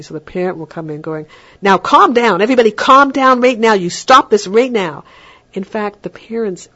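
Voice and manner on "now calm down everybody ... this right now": higher, much louder scolding shout; mock parental shout. Parent storms in shouting at fighting kids, mock yell. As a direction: bark orders over the kids' racket. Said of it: "if the parent comes in shouting".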